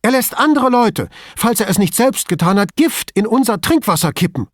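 high, loud furious tirade; outraged colleague rants at Brunetti, high and loud; angry outburst. A narrator's reading. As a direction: rage at him about the poison. Furious rant about poisoned water.

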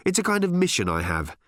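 high, insistent questioning; testy and demanding; snappy challenge. Acted. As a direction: press him impatiently, pitch climbing. Ford keeps snapping at new boss.

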